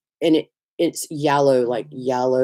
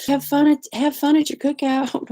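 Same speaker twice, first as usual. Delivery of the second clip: higher, singsong imitation of a viewer; mock commenter; 9 semitones higher than usual. Mimicking viewers' cookout comments, higher mock-cheerful voice. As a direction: chirp it like a clueless well-wisher. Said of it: "like, I'll see".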